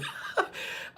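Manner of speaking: a short breathy laugh through tears; embarrassed laugh as emotion rises; tearful laugh. Real, not acted. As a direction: laugh it off while choking up.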